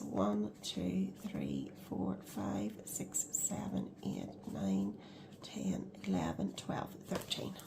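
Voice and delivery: low, whispered counting; counting under breath. Real, not acted. Budgeter counting envelopes under her breath, whispering. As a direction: count quietly to yourself.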